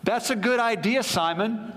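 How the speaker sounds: high-pitched, playful call to Simon; mock reply. Mock reply from Jesus to thought.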